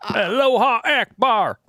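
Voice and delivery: high, slow, laughing; goofy laughing parody of a Texan drawl; laughing mock phrase. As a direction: stretch it out high while cracking up. Mocking Texan speaking Arabic.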